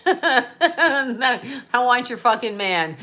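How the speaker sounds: slow, laughing tease. Acted. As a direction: laugh through the cheeky line. Cheeky joke about rivals' men.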